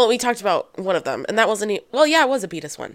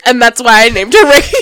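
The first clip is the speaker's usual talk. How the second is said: mock dramatic, laughing through it; laughing imitation; 10 dB louder than usual. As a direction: laugh while quoting her. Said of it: "an amazing cut of you going".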